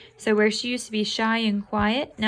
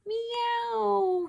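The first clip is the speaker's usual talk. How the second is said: squeaky kitten meow, pitched way up; high meow; 13 semitones higher than usual. Acted. The cat cries out to Mother, a high meow. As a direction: cry it out like a little cat. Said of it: "he cried".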